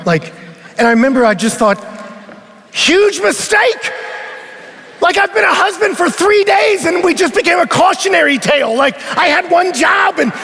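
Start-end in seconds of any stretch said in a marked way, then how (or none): 2.7-6.9 comic dread. Retold or imitated. Inner thought, comic dread.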